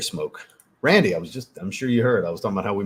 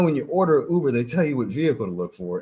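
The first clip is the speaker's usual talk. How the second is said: usual speaking voice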